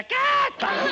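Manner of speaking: high, loud shrill yell of cut; shrieking cut. Retold or imitated. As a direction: shriek it like an impatient customer. Customer yelling cut.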